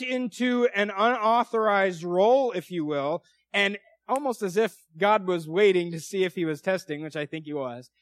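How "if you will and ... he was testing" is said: ordinary talk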